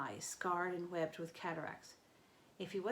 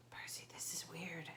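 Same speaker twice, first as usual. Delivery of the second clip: softer, voiceless whisper; 9 dB quieter than usual. A narrator's reading. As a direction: keep it secret from the crowd. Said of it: "Annabeth whispered".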